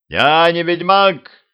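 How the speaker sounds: loud, high, slow protest; shouting at the crowd. A narrator's reading. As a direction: shout over the crowd, insisting. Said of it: "закричал он толпе".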